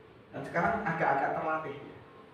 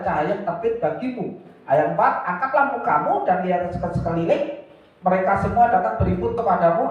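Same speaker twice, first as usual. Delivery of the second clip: loud, ringing Bible reading; scripture reading; 8 dB louder than usual. Acted. Preacher reading Isaiah aloud, loud and declaiming. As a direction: proclaim the verse to the hall.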